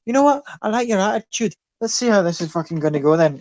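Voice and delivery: lively Irish brawler; cocky Irish bar fighter; Irish accent. Acted. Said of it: "Your Irish accent is fantastic".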